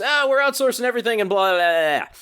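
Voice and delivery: high, fast mock whine of a common gripe; hammed-up griping, trailing into blahs; mock complaint. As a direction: rattle it off as a high-pitched parody rant. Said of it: "that was a common refrain".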